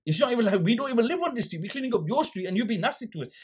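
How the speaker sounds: high, insistent complaint; reenacting an aggrieved outburst; retold indignant protest. Retells pleading with rude shopkeeper.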